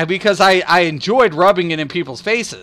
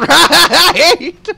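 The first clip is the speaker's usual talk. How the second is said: loud high laugh; 12 semitones higher, 8 dB louder than usual. Real, not acted. Laughing at his own trolling, loud high laugh.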